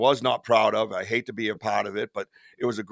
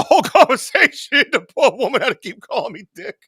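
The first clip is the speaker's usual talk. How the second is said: high, loud laughter breaking through the words; laughing; 10 semitones higher, 7 dB louder than usual. Real, not acted. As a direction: crack up while telling it. Laughing at own prank.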